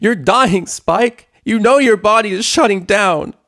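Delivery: furious high bellow. A narrator's reading. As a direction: roar it out in anguish. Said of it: "she bellowed".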